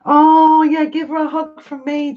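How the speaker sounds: excited gush. Real, not acted. Excited gush to friend's baby.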